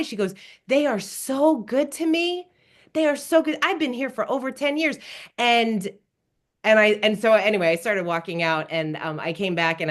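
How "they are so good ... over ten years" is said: high, heartfelt gushing praise; pitched up, delighted enthusiasm; excited about work. Retold or imitated. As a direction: imitate a worker who adores the job. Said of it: "she was so excited about her work".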